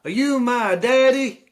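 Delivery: high, loud, slow chorus; mock kids' voice. Kids asking the new man if he is their dad, high and slow. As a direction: chant it slowly like a crowd of small kids.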